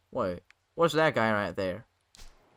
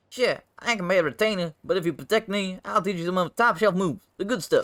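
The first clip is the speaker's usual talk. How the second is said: high-pitched, eager salesman-like pitch; wheedling rascal; 7 semitones higher, 4 dB louder, faster than usual. Acted. Bacchus bargains for protection with training, eager wheedling. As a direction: sell it hard in a high character voice.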